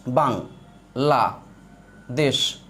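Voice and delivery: teacher sounding out each syllable. Shown as a demonstration. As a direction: split into separate syllables with pauses. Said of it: "তাহলে দেখেন".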